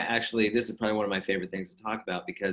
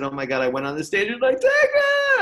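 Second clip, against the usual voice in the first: hammed-up wail that breaks much higher and louder; mock crying; 8 dB louder than usual. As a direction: wail it like a mock sob, slowing right down. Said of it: "I just started crying".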